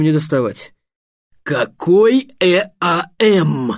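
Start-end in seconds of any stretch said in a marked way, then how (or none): 1.4-3.7 slow, emphatic outburst, letter by letter; irritated wizard exclaiming; exasperated exclamation. A narrator's reading. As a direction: spell it out in loud disbelief. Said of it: "воскликнул Щавель".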